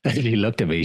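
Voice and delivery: ordinary delivery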